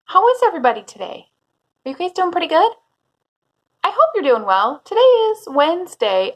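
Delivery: put-on bright singsong greeting to kids; cheerful teacher voice for little ones; school assembly speaker. Teacher greeting young class.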